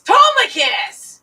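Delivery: yelling across the room. Real, not acted. Calling out to a viewer.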